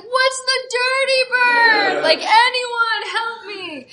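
high, slow, whiny panic; panicked plea. Retold or imitated. Mascot panicking in the circle, high slow wail. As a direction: beg for help in a high wail. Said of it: "I'm like".

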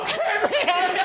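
very high, giddy yes; a drunk guest gladly agreeing, giddy; giddy high agreement. Retold or imitated. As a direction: squeak out eager agreement. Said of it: "And I'm like".

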